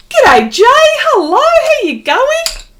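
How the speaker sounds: high, loud calling out, hello stretched slowly; excited shouted greeting. Real, not acted. Artist greeting viewers in chat, loud and delighted. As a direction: call out to a friend across the room.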